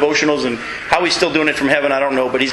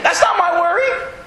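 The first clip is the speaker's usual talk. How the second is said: breezy shrug. Acted. Mock carefree answer to worry.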